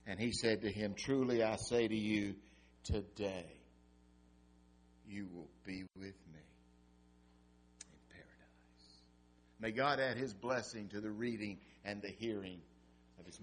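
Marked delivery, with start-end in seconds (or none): 1.0-9.0 tender promise. Acted. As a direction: promise it gently, hushed. Jesus answers the criminal.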